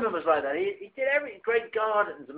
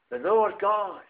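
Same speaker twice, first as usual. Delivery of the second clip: ordinary delivery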